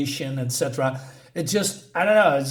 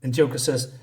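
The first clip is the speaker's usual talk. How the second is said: ordinary talk, nothing marked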